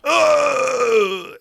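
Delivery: a high, drawn-out pained grunt; hammed-up death groan; mock dying grunt. Voicing the stabbed colonel.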